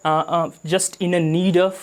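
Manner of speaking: ordinary talk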